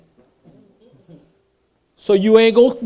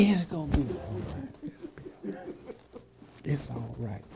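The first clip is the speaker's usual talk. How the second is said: much softer, hushed reassurance; soothing comfort; 7 semitones lower, 21 dB quieter than usual. Retold or imitated. Pastor sitting beside a member: much softer, soothing reassurance. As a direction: gently hush a hurting member. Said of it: "just sit next to me and say".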